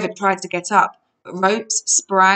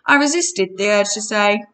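ordinary delivery, nothing marked

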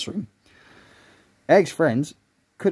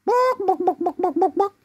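very high, rapid clucking yaps; animal noises. Acted. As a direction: imitate an animal in a squeaky repeated call. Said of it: "making animal noises".

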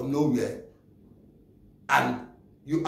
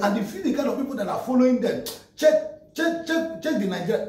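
exasperated; 6 semitones higher than usual. Real, not acted. Vlogger challenging viewers to check the followers, exasperated. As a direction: urge them to check, fired up.

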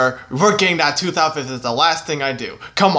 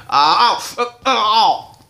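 acted pain; 5 semitones higher, slower than usual. Tooth yanked, crying out in pain.

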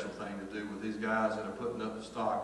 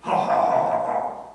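loud, forced ha-ha; mock laugh; 9 dB louder, slower than usual. Worker on the ladder answering a joke, mock laugh. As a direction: throw out a big fake laugh.

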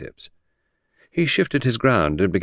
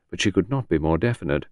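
usual speaking voice

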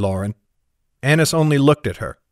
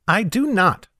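a quick, higher-pitched retort; snapped; 8 semitones higher than usual. A narrator's reading. Annis snapping back at Jem in the sewer, a touch indignant. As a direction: snap back at him, stung. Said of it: "snapped Annis".

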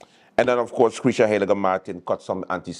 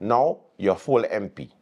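normal talking voice

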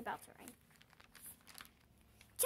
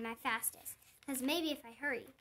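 usual speaking voice

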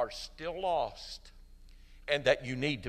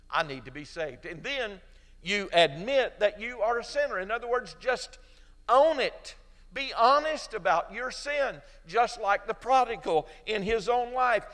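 preaching at full force; 3 semitones higher than usual. Acted. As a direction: preach it hard to the congregation. Preaching the prodigal son.

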